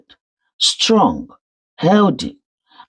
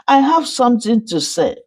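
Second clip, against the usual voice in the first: shaking voice; 8 semitones higher, faster than usual. Acted. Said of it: "her voice shaking".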